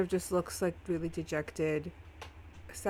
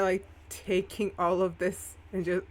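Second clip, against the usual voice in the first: ordinary delivery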